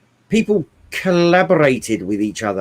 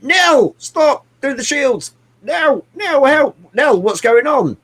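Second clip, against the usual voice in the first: much higher, urgent calls for Nell; imitating a panicky hero; 10 semitones higher, slower than usual. Imitating the hero calling to his ship Nell in a much higher panicky voice. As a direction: squeak out the orders in a flap. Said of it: "he's always like".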